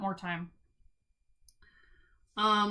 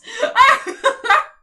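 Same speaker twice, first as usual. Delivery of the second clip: big, high burst of real laughter; loud laugh; 13 semitones higher, 14 dB louder than usual. Real, not acted. Reader cracking up at her own summary, loud high laugh.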